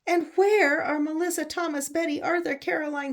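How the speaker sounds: high, loud shouted call. Acted. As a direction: yell it out to the crocodile, demanding answers. Reader calls out to the crocodile.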